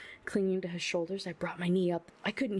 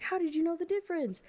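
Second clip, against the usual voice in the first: high surprised question; 12 semitones higher than usual. Retold or imitated. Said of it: "Naomi's like".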